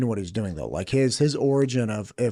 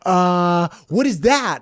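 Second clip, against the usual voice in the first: put-on higher, eager little-kid voice; 6 semitones higher, 6 dB louder, much slower than usual. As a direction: play the wide-eyed kid guessing. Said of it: "I'm like a little kid".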